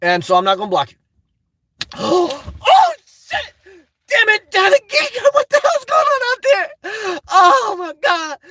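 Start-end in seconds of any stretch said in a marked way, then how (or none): 1.8-2.6 sudden gasp at the screen; sharp gasp. Real, not acted. Streamer gasps at a huge donation, sharp gasp. 2.7-8.4 excited shout. Real, not acted. Streamer thanks Daddy Geek for a huge donation, thrilled shout. Reacting to big donation live.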